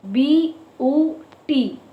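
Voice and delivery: "B U T" as three slow, separate sounds; broken into syllables. Shown as a demonstration. Teacher counting syllables for children, "B U T" slowly sound by sound. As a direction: space out "B", "U", "T" one beat each. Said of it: "Break this word into two".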